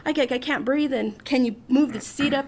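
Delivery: cramped in the back seat, mildly panicked; mock panic. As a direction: gasp it out like you're squashed. Cramped in police car back seat.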